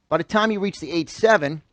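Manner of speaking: normal talking voice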